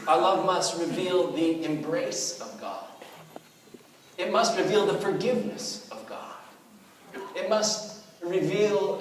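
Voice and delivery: slow, emphatic preached lines; preaching a slide. Acted. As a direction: proclaim each line with weight.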